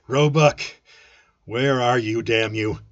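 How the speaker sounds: raging shout at the hidden enemy; roaring. Acted. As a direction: bellow across the bar. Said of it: "kicked over my chair and roared".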